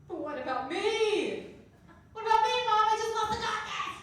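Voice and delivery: a hammed-up self-pitying wail; mock sob to mom about losing a contest; whiny self-pity. Whining to mom about herself.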